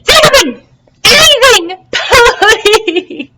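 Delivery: high, loud desperate begging; plead, near breaking. Acted. Plankton begging the mussel.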